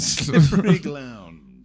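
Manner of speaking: slow, loud, high drawled slippery ground with glound. Retold or imitated. Mocking the ranchers' pronunciation, slow and loud. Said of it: "glound, which is what they call it".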